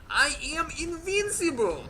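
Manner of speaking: put-on booming high Russian-accented boast; slow, loud Russian accent proclamation. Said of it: "in a Russian accent".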